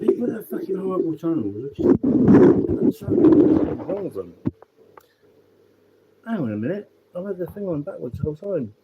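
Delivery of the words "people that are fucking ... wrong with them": angry condemnation. Real, not acted. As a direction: hammer home disgust at animal abusers. Condemning animal cruelty.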